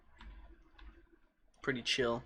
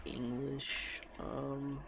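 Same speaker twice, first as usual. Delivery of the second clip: ordinary talk, nothing marked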